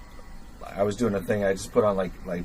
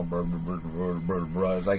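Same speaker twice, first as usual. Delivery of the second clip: flat low man's drone; 5 semitones lower than usual. Shown as a demonstration. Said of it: "that isn't musical".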